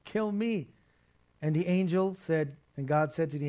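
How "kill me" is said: higher, louder pleading cry to the Lord; pleading David. Retold or imitated. Retelling David begging the Lord, mock anguished plea. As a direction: beg God like David in despair. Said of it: "he was like".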